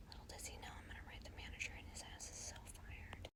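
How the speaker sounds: fast conspiratorial whisper; whispered aside. Acted. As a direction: lean in and whisper the payback. Gleeful aside about revenge.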